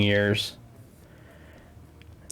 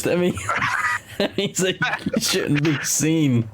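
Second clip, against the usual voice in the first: talking through laughter, pitched higher; laughing; 6 semitones higher than usual. Real, not acted. Host explains a face-for-radio joke, laughing. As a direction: crack up while getting the words out.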